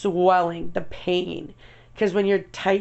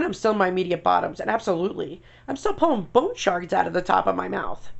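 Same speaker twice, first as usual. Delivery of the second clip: usual speaking voice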